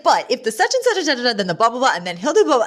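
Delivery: Val's mafia ramble. Retold or imitated. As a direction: rattle off mafia lingo in a high voice. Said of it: "she's like".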